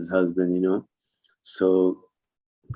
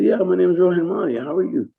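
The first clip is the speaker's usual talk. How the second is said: higher, cheerful self-intro; answering Kenny in a raised friendly voice; mock polite self; 10 semitones higher, 4 dB louder than usual. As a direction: perk up and introduce yourself. Rohan quoting himself.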